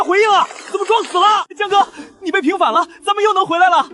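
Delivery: high, elated cheer; overjoyed. Acted. Agent telling him his name is cleared, overjoyed. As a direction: break the good news in high excitement.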